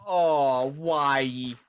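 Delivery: ordinary talk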